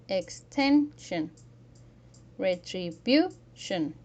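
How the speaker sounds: "extension", "retribution", slow, loud on "ten" and "bu"; "extension", "retribution", drawn-out next-to-last syllable; second-to-last syllable stress. Shown as a demonstration. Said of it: "Stress the second to last syllable".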